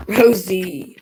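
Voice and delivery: loud, drawn-out call of the dog's name; scolding a dog. Real, not acted. Scolding the dog mid-stream.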